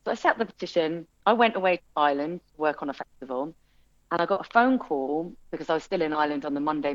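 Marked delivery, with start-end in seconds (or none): none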